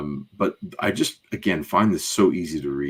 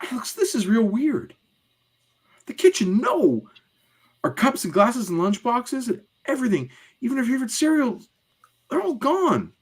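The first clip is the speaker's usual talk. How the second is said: put-on very high-pitched child voice; hammed-up girl in a panic; alarmed young girl. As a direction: list the losses in mounting dismay. Plays Julie finding home empty.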